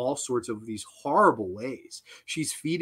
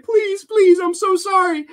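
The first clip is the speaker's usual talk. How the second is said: high, loud imitation of pleading; mock begging; 7 dB louder than usual. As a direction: beg in an exaggerated high-pitched cry. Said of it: "making him beg for her".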